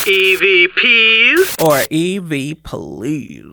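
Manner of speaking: slow, drawn-out host announcement; game show announcer. Acted.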